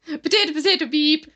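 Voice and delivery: high, loud put-on Irish; mock Irish accent. Echoing co-host's joke in a loud mock Irish accent. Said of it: "they say potatoes and they say beep".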